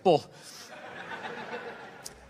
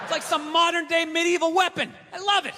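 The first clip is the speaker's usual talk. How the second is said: gleeful; 7 semitones higher than usual. Real, not acted. Gleeful about treadmill falls.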